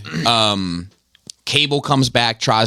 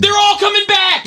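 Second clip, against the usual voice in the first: much higher near-yell of disbelief; exasperated shout. Real, not acted. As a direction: shout it at the whole fandom. Exasperated rant at fans.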